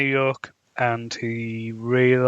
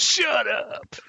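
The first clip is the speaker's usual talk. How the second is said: sudden high shouted snap, hammed-up; mock outburst; 12 semitones higher than usual. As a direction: bark it out like a rattled hero. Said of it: "So he goes".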